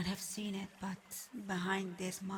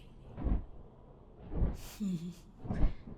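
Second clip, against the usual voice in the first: amused chuckle. Acted. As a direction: chuckle at the knight's confidence. Amused by knight's confidence.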